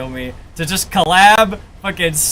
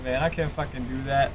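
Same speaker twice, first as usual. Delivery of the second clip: normal talking voice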